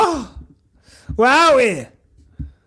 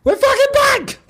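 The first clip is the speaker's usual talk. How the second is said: excited outburst; 10 semitones higher than usual. Real, not acted. Celebrating team's return.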